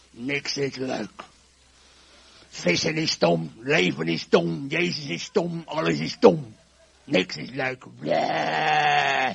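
hammed-up slow, loud sulk; grumpy. Shown as a demonstration. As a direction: grumble each phrase like a sullen fisherman. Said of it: "hoe vis je nou zagrijnig?".

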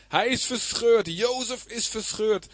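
high, slow lament; crying out. Acted. Jacob learning his son is dead, crying out in grief. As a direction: draw each word out in acted anguish. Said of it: "riep uit".

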